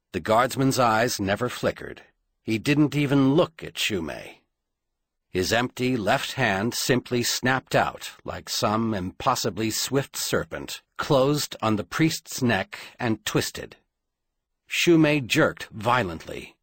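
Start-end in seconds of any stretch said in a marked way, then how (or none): none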